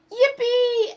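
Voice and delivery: high loud yippee; squeal. Acted. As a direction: squeal it with joy. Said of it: "Eddie squealed".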